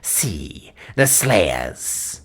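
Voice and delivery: ancient witch hissing her command; old witch voice. Acted. Old witch Gagul addressing the king.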